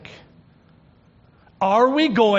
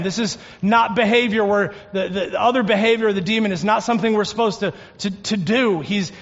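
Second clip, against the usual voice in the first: ordinary talk, nothing marked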